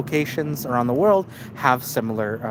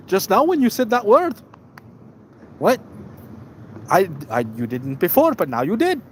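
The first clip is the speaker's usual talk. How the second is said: mock diplomat accusing; 6 semitones higher than usual. Diplomat accuses him, acted exchange.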